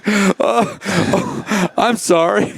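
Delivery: high, laughing oh, oh, sorry; embarrassed laughing apology. Retold or imitated. Rider realizes he's in a gynecology office, high laughing apology. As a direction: apologize through laughter, flustered. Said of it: "I'm like".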